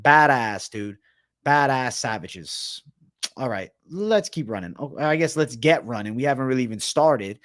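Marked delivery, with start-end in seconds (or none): none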